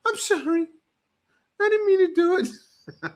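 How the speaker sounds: very high, whiny pleading voice; mock scared woman. Woman apologizing to the man, high mock-scared pleading. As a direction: squeak out the apology like a frightened girl. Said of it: "That was the face she made".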